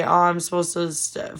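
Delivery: usual speaking voice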